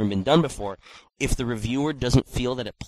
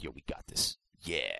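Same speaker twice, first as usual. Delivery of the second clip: much lower, quiet dude voice; low bro voice; 11 semitones lower, 14 dB quieter than usual. Retold or imitated. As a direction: drop into a laid-back low bro. Imitating Rockstar as a low bro.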